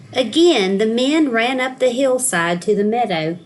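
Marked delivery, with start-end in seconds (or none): none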